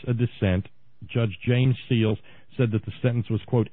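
ordinary talk

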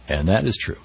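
ordinary talk, nothing marked

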